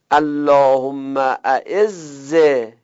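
very slow اعزهی with a long final hi; drawn-out recitation. Shown as a demonstration. Teacher showing students the third form of اعزه. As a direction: chant اللهم اعزهی slowly and stretch the ending.

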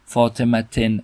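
فاطمتن ending in -ten; tanvin -en ending. Shown as a demonstration. Teacher shows students a form not used, فاطمتن ending in -ten. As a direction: sound the final -en in فاطمتن. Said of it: "نه اینطور نمیگیم".

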